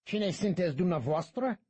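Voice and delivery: the guide angrily challenging the priest; angry demand. A narrator's reading. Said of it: "demanded the taller guide angrily".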